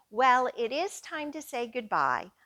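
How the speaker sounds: usual speaking voice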